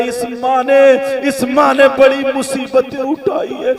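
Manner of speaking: ordinary talk, nothing marked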